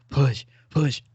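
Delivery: pushing harder, coaching a birth; labor coach. Retold or imitated. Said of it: "You sound like a labor coach".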